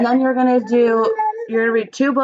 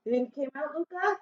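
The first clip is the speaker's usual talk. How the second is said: much softer, higher follow-up question to Luca; 5 semitones higher, 11 dB quieter, faster than usual. Real, not acted. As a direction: lean in and ask again softly. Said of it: "asks the class about the tooth".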